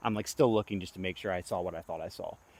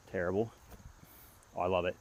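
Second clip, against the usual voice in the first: ordinary talk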